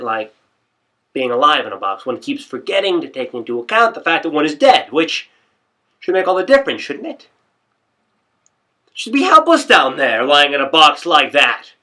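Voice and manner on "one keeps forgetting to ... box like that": loud, high rant building toward a shout; agitated rant. Acted. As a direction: work yourself up over lying dead in a box. Agitated rant about being dead.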